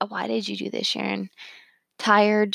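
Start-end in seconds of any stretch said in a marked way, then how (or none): 0.1-1.3 much softer, muttered to herself; quiet self-reproach. Retold or imitated. Sharon chiding herself about the garage, softly. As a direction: drop the voice and scold yourself under your breath. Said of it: "instead of me, like".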